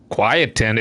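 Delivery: quietened? asked, puzzled; questioning pronunciation. Shown as a demonstration. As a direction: try quietened again doubtfully. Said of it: "Is that a fucking word?".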